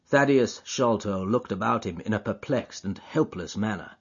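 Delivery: plain reading voice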